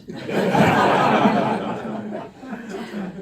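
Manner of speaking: a long, high, breathy burst of laughter. Real, not acted. Speaker laughing with the audience at the translation, real laughter.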